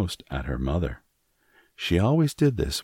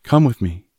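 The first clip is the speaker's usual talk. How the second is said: urgent call; 6 semitones higher, 8 dB louder than usual. A narrator's reading. Fleeing mother calls in panic.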